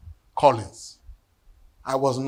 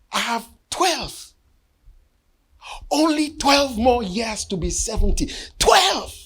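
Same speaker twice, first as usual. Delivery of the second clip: climbing higher until the final Twelve! rings out; rising alarm; 7 semitones higher than usual. Real, not acted. Pastor counting his remaining years to the congregation, rising to an alarmed cry. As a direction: let the shock of counting build to a cry.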